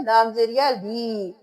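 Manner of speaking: "ली" stretched one alif length; one-beat madd. Shown as a demonstration. Teacher shows students "ली" lengthened by one alif. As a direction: lengthen "या ली" slightly, one count. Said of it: "एक अलिफ के बराबर खींचेंगे".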